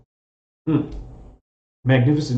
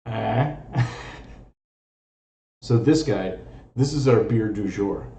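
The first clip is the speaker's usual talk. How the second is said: normal talking voice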